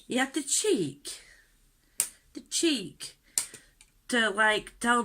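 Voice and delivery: high, drawn-out outrage on "cheek"; real indignation. Real, not acted. Girlfriend venting about boyfriend's nerve, indignant. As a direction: hit "cheek" high and let it fall, scandalised. Indignant at what he told her.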